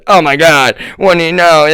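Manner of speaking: mock them scoffing loudly; mock outraged relatives. Relatives scoffing at his January sixth remark, mock outraged. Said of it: "it's like".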